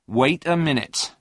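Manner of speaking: brisk objection that holds its ground; firm. A narrator's reading. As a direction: halt him firmly. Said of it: "said Peter firmly".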